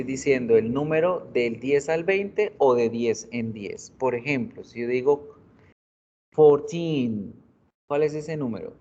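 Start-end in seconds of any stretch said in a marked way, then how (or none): 6.3-7.3 put-on "fourteen" with a long, stressed final -teen. Shown as a demonstration. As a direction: lean on the last syllable of FOURTEEN. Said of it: "Ahí porque les estaba acentuando". ("fourteen" con fuerza en la última sílaba)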